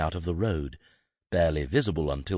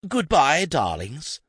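high, loud diva goodbye that drops off; dramatic farewell; 10 semitones higher, 5 dB louder, slower than usual. Acted. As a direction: sing it out like a stage exit.

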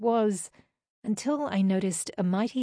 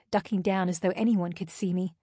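ordinary delivery

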